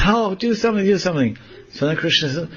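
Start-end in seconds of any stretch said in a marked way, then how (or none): 0.0-1.4 high, urgent begging; acted plea. Radharani hanging up in the tree, high pleading voice. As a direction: beg for rescue in a raised voice.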